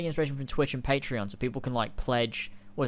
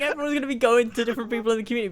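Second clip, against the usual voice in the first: ordinary talk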